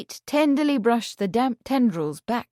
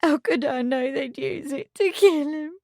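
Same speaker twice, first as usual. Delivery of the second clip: tearful; 6 semitones higher than usual. A narrator's reading. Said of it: "tears coursing down her cheeks".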